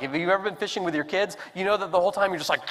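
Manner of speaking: ordinary talk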